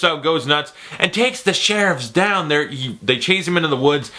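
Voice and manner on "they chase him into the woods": ordinary delivery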